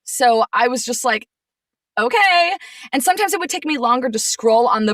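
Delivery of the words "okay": high, loud, drawn-out okay of disbelief; sarcastically agreeable, higher and louder; incredulous okay. Retold or imitated. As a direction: go along with it, pitched way up in disbelief. Retold reaction to broken laptop.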